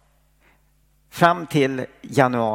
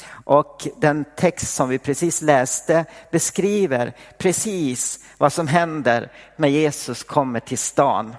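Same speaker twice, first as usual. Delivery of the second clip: normal talking voice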